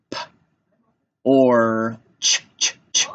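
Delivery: P, or, ch separated, ch repeated; tapped-out sounds. Shown as a demonstration. Teacher tapping three sounds, segmented. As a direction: pop P, hold or loudly, repeat ch. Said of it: "Let's tap it".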